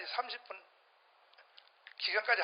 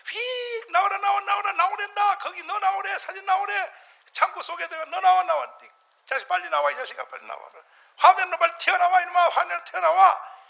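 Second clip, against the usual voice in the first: loud, high barking at the file to come out; playful shouted summons; mock shouting command; 8 semitones higher, 6 dB louder than usual. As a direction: yell it out like bossing a servant. Acting out calling a file out.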